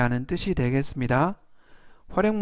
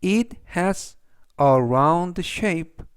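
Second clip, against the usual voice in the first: "It has a round shape" read very slowly, word by word; deliberate classroom read; slow, clear reading; much slower than usual. Shown as a demonstration. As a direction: spell it out slowly for learners. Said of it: "그래서 전체적 의미는".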